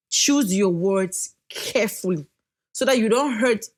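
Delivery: normal talking voice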